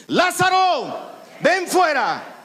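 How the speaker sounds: Jesus shouting at Lazarus's tomb, full commanding shout; shout with authority. Shown as a demonstration. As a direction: order the dead man out of the grave. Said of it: "Una cosa así con autoridad".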